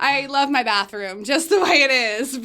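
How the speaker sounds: laughing through the words. Real, not acted. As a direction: say it while laughing. Guest laughing while joking.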